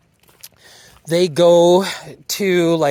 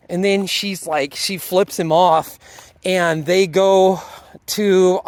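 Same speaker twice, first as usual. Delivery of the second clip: usual speaking voice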